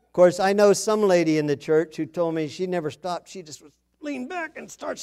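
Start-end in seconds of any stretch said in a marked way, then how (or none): none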